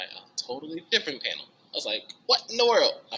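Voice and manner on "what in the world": high, loud mock outrage of disbelief; shocked disbelief. Telling how the rules stunned her, loud shocked cry. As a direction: throw the line up high like you can't believe it.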